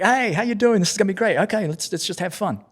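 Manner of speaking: high, bright-voiced friendly greeting; cheery Eddie Izzard. Imitating Eddie Izzard greeting him on set, high and cheery. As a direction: bounce in like a delighted new friend. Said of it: "He's like".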